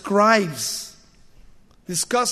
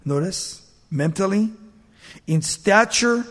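usual speaking voice

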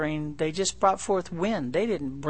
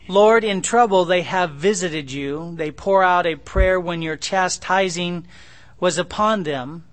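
louder, slow solemn reading of the verse; scripture reading; 2 semitones higher, 6 dB louder than usual. Acted. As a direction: proclaim the verse to the congregation.

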